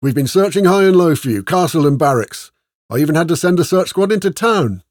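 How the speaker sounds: loud, high-pitched scolding from a sergeant; berating sergeant. A narrator's reading. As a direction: bawl out the men who went missing. Sergeant berates missing soldiers.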